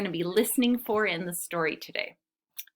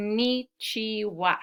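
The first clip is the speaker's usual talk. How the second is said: "konnichiwa" slowly split as kon-ni-chi-wa; four measured beats on "konnichiwa"; syllable by syllable; slower than usual. Shown as a demonstration. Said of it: "I heard four syllables".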